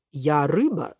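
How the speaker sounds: low, measured, slowing fish voice; Лосось. A narrator's reading. Salmon introducing himself to the swimmers, low and slow. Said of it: "говорит незнакомец".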